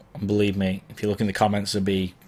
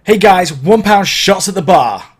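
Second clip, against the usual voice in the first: big, loud and high, slow and from the chest; hammed-up MC hype call; booming mic announcement; 9 semitones higher, 12 dB louder than usual. Shown as a demonstration. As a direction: project it to a whole club. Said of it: "get on the mic and be like".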